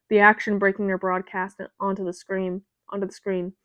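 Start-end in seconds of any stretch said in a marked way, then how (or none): none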